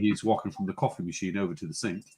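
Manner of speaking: observe the animal in hushed, deep narration; David Attenborough. Acted. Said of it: "This feels like David Attenborough".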